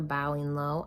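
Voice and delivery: ordinary delivery, nothing marked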